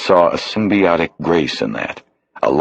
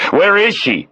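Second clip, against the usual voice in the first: shout. Acted. Bosch pinning a stranger to the door, shouting. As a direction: bark the question at the intruder. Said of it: "he shouted".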